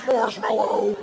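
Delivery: high, slow, drawn-out wail; hammed-up wail of the safe word; distressed cry. Man grabbed by bear calling for rescue, distressed wail.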